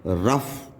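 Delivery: रफ with its GH turned into F. Shown as a demonstration. Teacher giving learners a second GH example, F sound. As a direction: finish रफ on a clear F. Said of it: "ये फा की sound देता है".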